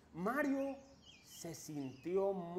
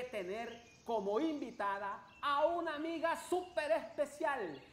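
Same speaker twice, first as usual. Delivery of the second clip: put-on bright, upbeat children's host; kids show host; 5 semitones higher, 7 dB louder than usual. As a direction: welcome the little ones cheerfully. Host greets kids in forest.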